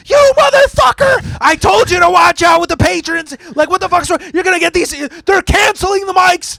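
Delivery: very high, loud screeching tirade; hammed-up high-pitched angry shouting; imitated furious rant. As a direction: shriek a scolding in mock fury. Voices Lucia's angry messages.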